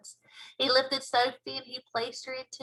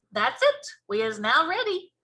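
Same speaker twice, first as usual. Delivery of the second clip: higher and louder, decisive; BFG voice; 5 semitones higher, 6 dB louder than usual. Acted. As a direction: giant announcing triumphantly. BFG declaring.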